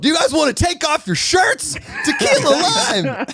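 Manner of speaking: high, hammed-up party shout; tequila lime voice. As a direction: yell it like a wild spring-breaker. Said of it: "Wait till you get tequila lime".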